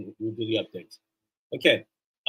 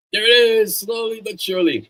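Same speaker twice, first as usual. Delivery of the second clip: louder and higher, a happy there-it-is; relieved excitement; 9 semitones higher, 8 dB louder than usual. Real, not acted. Host when the stream tool finally shows the book, excited. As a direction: greet the loaded screen with a triumphant lift. Screen share finally appears.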